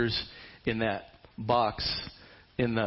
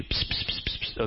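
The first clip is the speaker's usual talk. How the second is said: hiss of psst. Acted. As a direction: call someone secretly with psst. Whispering a secret signal.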